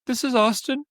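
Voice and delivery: put-on shy boy introducing himself; nervous teen caller. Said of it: "I'm like".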